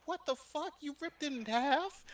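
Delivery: high-pitched incredulous question; high shocked friend; shocked disbelief. Retold or imitated. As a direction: gasp it out in disbelief. Friend's shocked reaction retold.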